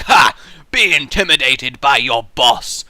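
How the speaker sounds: loud, slow mocking villain boss; gloating boss. Boss taunting his employees, a loud gloating voice. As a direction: lord it over the staff. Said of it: "so I can be like".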